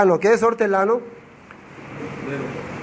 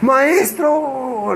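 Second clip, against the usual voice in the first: amazed recognition; 7 semitones higher than usual. Acted. Mary recognizing her teacher.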